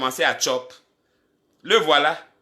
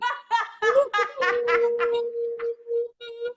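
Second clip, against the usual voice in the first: high-pitched burst of helpless laughter; drawn-out high cackle; long high laugh. Real, not acted. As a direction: crack up in a long, squealing laugh. Bursts out laughing at friend's excuse.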